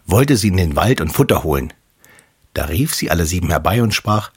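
ordinary delivery

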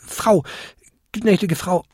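high, flustered little goblin; timid, high-pitched, faltering politeness; stammering apology. A narrator's reading. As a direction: grope haltingly for the proper address. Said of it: "stammelte der kleinste Winterkobold".

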